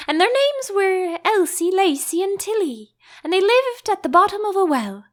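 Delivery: high squeaky dormouse storytelling; sleepy dormouse. Acted. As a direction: tell the tale in a rush, like a tiny creature.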